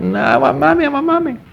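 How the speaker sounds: put-on high-pitched child's whine, climbing at the end; imitated wailing child. Son cuts off father at bedtime, imitated high whine. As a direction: mimic a little boy insisting through a wail.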